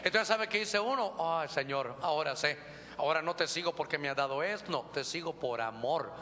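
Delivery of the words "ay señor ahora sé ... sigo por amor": a believer confessing to God; humble prayer. Acted. Voicing a believer praying.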